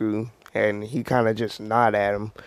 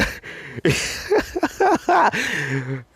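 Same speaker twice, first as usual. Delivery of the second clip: high-pitched real laughing fit; burst of laughter; 11 semitones higher than usual. Real, not acted. Storyteller cracks up at the stick joke, high burst of laughter. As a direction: crack up helplessly mid-story.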